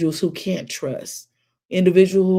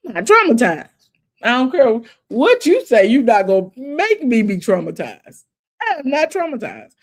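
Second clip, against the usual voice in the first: insistent; 4 semitones higher, 6 dB louder than usual. Real, not acted. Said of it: "insisting with a laugh".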